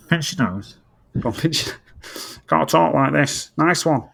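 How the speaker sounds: pinched-nose Mancunian demo; nasal Mancunian. Shown as a demonstration. Teaching a co-host the accent, nasal Mancunian. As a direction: show off a very nasal Manchester accent. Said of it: "You gotta speak very nasally".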